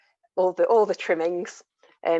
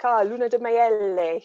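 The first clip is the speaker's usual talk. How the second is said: put-on terrible Italian accent; 6 semitones higher, 4 dB louder than usual. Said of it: "terrible accent, I'm sure".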